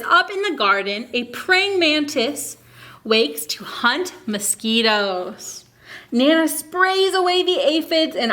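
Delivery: slow, lilting picture-book reading; reading to children. Acted. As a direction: read it aloud to a class of little kids. Reads picture book to kids.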